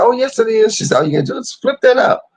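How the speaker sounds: high, bright nurse voice, quick; chirpy nurse. Retold or imitated. Nurse cheerfully correcting mama, voiced high and chirpy.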